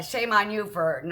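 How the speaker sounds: usual speaking voice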